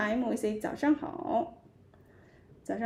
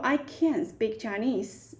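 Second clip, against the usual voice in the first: normal talking voice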